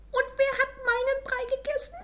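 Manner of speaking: very high, loud little bear voice; squeaky Baby Bear. A narrator's reading. Baby Bear asks about his porridge, high squeak. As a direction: squeal it like a tiny bear cub. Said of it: "quiekte Baby Bär mit seinem hohen Stimmchen".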